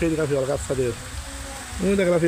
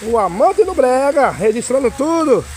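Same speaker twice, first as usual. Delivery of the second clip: excited shout; 3 semitones higher, 5 dB louder than usual. Real, not acted. Vlogger shouting his name to viewers on a rainy Sunday, excited. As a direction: hype it up, shouting to the fans.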